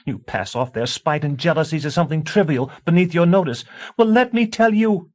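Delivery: high, loud screaming tirade; screaming wife. A narrator's reading. A wife screaming warnings at her husband. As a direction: shriek the warning at him. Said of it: "Tansy, stop screaming".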